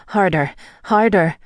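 pleading with a lift in pitch; moaned. A narrator's reading. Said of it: "I moaned".

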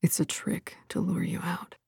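very soft breathy whisper close to her ear. Acted. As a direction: murmur a warning right at her ear. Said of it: "his breath warm against her ear".

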